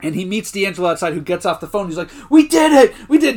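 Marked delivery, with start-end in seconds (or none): 2.3-3.4 high, loud victory cry; mock triumphant shout. Andy celebrating the sale to D'Angelo, hammed-up elation. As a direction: imitate an overjoyed salesman.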